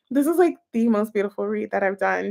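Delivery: usual speaking voice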